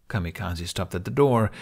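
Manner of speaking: ordinary delivery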